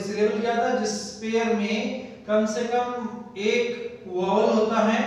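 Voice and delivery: ordinary talk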